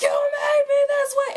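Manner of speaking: high, loud mock scream of Jonathan; retold scream. Jonathan losing it at Valentine, a high loud mock scream. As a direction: shriek it at the top of your lungs, imitating him. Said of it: "screaming at the top of his lungs".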